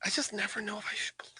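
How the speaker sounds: hushed conspiratorial aside; breathy whispered doubt. Real, not acted.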